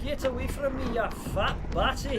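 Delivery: high, loud Scottish yelling; shouted Scottish accent. Pretend. Player urges Six to flee, shouting in Scottish accent. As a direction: yell urgent orders in a Scottish accent.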